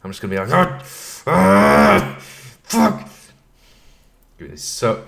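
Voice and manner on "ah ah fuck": high, loud yell of frustration; hammed-up furious screaming; angry outburst. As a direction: scream out mock rage, then curse. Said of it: "get so angry".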